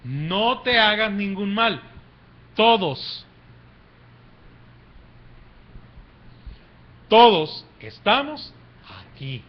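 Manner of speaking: slow, emphatic call to hold back; measured preacher's cry with pauses. Acted. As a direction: call it out slowly, punching key words. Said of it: "Pablo clamó a gran voz".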